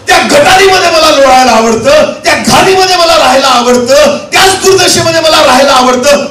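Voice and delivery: high, forceful sermon delivery; impassioned preaching. Acted. Preacher voicing a sinner content in filth, impassioned preaching. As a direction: preach it in ringing, driven phrases.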